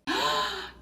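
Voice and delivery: big sniffing gasp of delight; excited gasp. Pretend. Smelling the baking cobbler.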